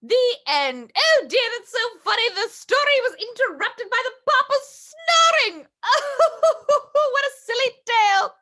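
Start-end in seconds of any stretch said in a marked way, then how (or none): 5.8-6.9 big amused burst of laughter; loud laugh. Real, not acted. Grandma laughs at ending.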